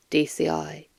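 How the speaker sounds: ordinary delivery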